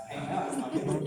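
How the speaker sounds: breaking into laughter while talking; words tipping over into a laugh. Real, not acted. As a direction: crack up as you finish the joke.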